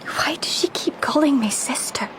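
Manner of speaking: dreamy whisper. Acted. Rhiannon whispering dreamily on the cliff edge. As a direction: drift the words out, far away. Dazed woman on a ledge.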